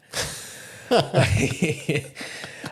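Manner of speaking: airy high laugh without voice; breathy laugh. Real, not acted. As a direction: laugh silently at the mishap. Laughing at dropping knife.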